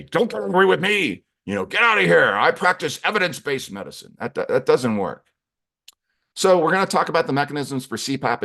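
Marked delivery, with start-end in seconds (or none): 0.0-4.1 raised, higher mock bluster; defensive. Doctor rebuffs an angry patient, defensive. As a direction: push back loudly, like a doctor under attack. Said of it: "put on your defensive medicine and say".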